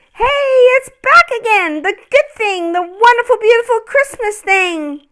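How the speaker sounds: very high, loud joyful cry; excited cry. Acted. Ornament Noel cries out as Christmas returns, high and joyful. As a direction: burst out with delight. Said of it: "cried Noel".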